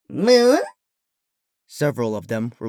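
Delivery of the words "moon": very high, louder questioning squeak; curious little creature. A narrator's reading. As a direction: ask it like a tiny puzzled creature.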